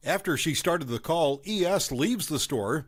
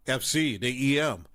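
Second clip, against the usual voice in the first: ordinary delivery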